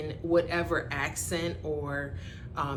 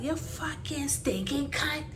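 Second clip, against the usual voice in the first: put-on slow, venomous island-accent insult; Bajan accent; 8 semitones higher, 5 dB quieter than usual. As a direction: spit it out in your Bajan accent. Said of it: "my accent came out".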